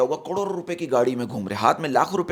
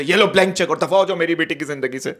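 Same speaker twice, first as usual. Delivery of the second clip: put-on dramatic old Bollywood father voice; a hint of a stern film dad. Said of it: "पुरानी फिल्मों वाले बाप".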